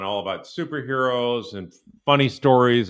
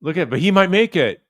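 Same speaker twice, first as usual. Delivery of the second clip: higher, louder mock of her dread; mocking her gloomy tone; 8 semitones higher, 7 dB louder than usual. Commentator mimics the mother's pessimistic line, higher and louder. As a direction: echo her line back with exaggerated dread. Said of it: "That does not sound optimistic".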